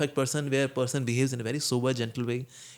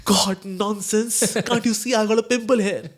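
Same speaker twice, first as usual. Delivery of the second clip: higher, louder slow annoyed retort; irritated snap; 7 semitones higher, 4 dB louder, slower than usual. Retold or imitated. Person with a pimple snaps at the teasing friend, annoyed higher. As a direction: snap back as if stung. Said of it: "since I am having pimple... I felt hurt".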